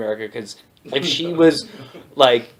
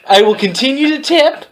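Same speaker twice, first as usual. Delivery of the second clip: high-pitched, hammed-up righteous complaint; mock indignant customer; 6 semitones higher, 4 dB louder than usual. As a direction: build a petty grievance into a grand speech. Acted customer escalating a complaint.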